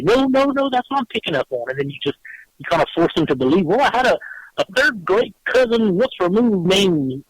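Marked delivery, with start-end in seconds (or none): 0.0-1.7 a hint of a pushy psychic, higher at first; hammed-up fake medium pressing the client; insistent mock psychic. Psychic mocked by a cop pressing a client, mild insistence. 3.7-7.2 higher, slow, hammed-up desperate guessing; a flustered client grasping for a name; pleading client. Client mocked as pressured by fake psychic, higher mock pleading.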